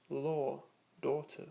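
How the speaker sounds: British "law, daughter" with rounded or vowel, no final r; British style accent. Shown as a demonstration. Teacher respelling words in British style, British rounded or in "law, daughter". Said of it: "with a more British style accent".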